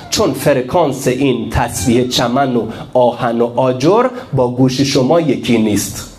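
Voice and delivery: usual speaking voice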